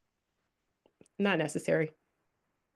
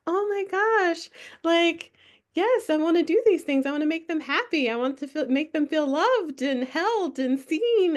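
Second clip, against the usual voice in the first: high, eager, gushing inner voice; gushing people-pleaser; 9 semitones higher than usual. Retold or imitated. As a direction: gush like an eager-to-please friend. Mimics own people-pleasing inner voice.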